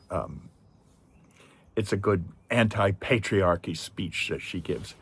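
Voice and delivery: ordinary talk, nothing marked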